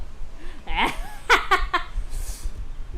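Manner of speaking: high, long laughing fit; burst of laughter. Real, not acted. Laughing at bullying Mickey.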